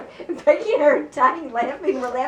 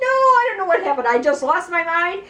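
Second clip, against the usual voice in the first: high, pleading retold apology; imitating a caught-out kid, pitched up; panicked excuse; 5 semitones higher than usual. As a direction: plead temporary madness to your father. Repeats panicked excuse to father.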